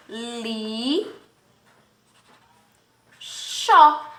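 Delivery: "li" held long, a long pause, then a firm "xó"; syllable separation. Shown as a demonstration. Teacher demonstrating syllable separation, "li" and "xó" far apart. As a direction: break the word into "li" and "xó". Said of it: "fazermos a separação silábica".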